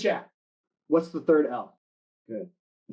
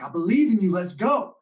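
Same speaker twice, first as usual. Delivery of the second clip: louder, higher "believe", pumped up; hyped encouragement; 5 semitones higher, 4 dB louder than usual. Retold or imitated. Senior hypes the shaking sophomore, loud and high. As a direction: fire him up for the last rep. Spotter hyping lifter on rep four.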